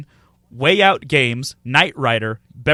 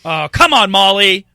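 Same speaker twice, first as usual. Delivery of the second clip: mock exasperated; 6 semitones higher, 9 dB louder than usual. Mock scolding Molly for tripping switch.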